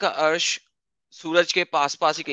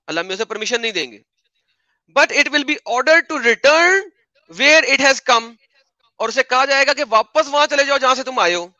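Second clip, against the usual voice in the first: high, loud, theatrical mock recital; sarcastic storytelling, pitched high and emphatic; sarcastic reading; 6 semitones higher, 3 dB louder than usual. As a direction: ham up the reading in a raised, mocking voice. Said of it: "आपकी tone ऐसी sarcastic आ रही थी".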